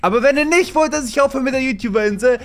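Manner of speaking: high loud plea to fans; rallying shout. Real, not acted. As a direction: whip the viewers up to comment.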